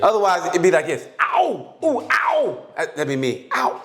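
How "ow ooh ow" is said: high, hammed-up ow cries; mock yelps of pain. Mocking a contestant bitten, high yelping ows. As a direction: yelp like something's biting you. Mocking woman attacked in swamp.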